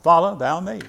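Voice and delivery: ordinary talk, nothing marked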